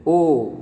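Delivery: "ओ" held long as a full o; vowel demonstration. Shown as a demonstration. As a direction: sound out "ओ" clearly for learners. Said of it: "इसका उच्चारण मैंने बता दिया है".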